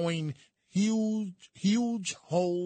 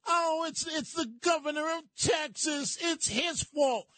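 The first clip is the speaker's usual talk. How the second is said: mocking whiny quote; 8 semitones higher than usual. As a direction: parody a whiny complainer blaming the governor. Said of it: "you're talking from La La Land".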